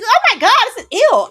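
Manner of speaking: high loud disgusted shriek; retold disgusted scream. Woman recoiling from a man on TV, shrieking. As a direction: screech ew in disgust. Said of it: "I actively screamed".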